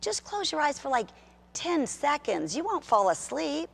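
sweet, lulling temptation voice; lying inner voice. Retold or imitated. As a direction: coax like a sly voice in your head. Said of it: "that voice was saying".

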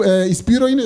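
usual speaking voice

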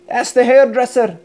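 plain reading voice

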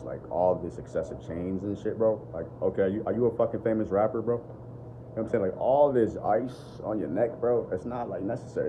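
normal talking voice

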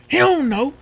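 high, loud mocking mom's yell; mock mom shout. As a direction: yell it like the angry mother. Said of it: "She was like".

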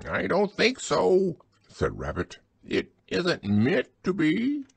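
high, loud put-on squeak; disguised voice. A narrator's reading. Rabbit disguising his voice to fool Pooh, high and loud. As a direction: pretend to be someone else, way up high. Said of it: "in a different sort of voice".

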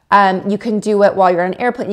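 ordinary talk, nothing marked

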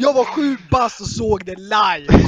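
loud, slow hammed-up brag; acted boast. Man bragging he was there at seven, mock-indignant loud boast. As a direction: stretch it out loudly like an outrageous eyewitness claim. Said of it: "Alltså, lyssna på den här".

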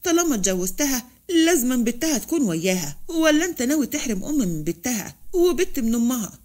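ordinary delivery, nothing marked

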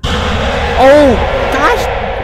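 high, loud startled yelp. Real, not acted. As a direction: jump at the sudden scare. Sudden scare in game.

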